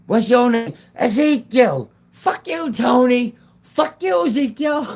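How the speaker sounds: high-pitched playful hollering; mock long-distance shouting match; yelled exchange. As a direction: holler back and forth in a squealed-up pitch. Reenacting yelled exchange across a field.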